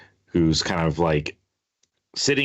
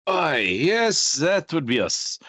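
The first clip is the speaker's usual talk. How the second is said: higher, hearty sailor reply; crewman voice; 10 semitones higher, slower than usual. Acted. Sailor answering the new captain, hearty. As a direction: answer like an eager old sailor.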